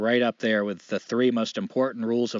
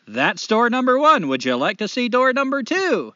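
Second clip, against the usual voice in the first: devil; 9 semitones higher, 5 dB louder than usual. Acted. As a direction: greet him like a grinning host of hell. Devil voiced.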